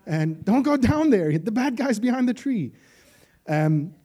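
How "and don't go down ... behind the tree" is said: high, loud warning shouted at the screen; horror-movie yell. Pretend. Horror fan shouting at the screen, loud high yell. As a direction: yell at the girl in the horror film.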